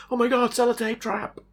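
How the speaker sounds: very high panicked little bug; squeaky bug voice. Pretend. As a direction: squeal it like a tiny trapped bug. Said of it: "imagine Dave crawling in there going".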